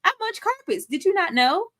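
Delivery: high-pitched, put-on flirty come-on; Judith. Judith propositions Maddie about the fire escape, in a high mock voice. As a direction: pitch it way up, as a forward woman propositioning her friend. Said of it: "Judith is just like".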